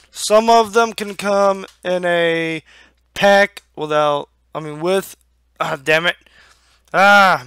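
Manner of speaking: ordinary delivery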